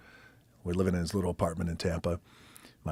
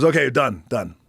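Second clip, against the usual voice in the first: much louder curt call; 5 semitones higher, 10 dB louder than usual. Retold or imitated. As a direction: cut the match off short. Pat stopping the match.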